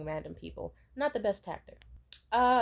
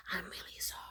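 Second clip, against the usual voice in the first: whisper; 11 dB quieter than usual. Retold or imitated. Sympathetic people in line whisper.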